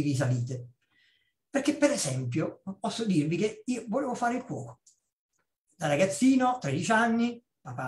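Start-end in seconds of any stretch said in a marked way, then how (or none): none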